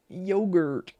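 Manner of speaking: yogurt said the English way, g as in go. Shown as a demonstration. Before switching to Dutch, the English yogurt. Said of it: "Shall I pronounce that in Dutch?".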